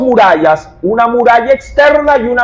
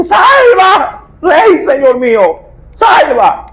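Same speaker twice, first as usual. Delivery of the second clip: desperate last-breath cry; 7 semitones higher, slower than usual. Retold or imitated. Starving woman begging the king to save her, desperate shout. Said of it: "Ese grito debió haberle salido".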